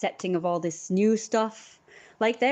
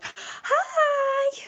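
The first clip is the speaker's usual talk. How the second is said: mock cheery hi. Greeting teens in mock cheery tone.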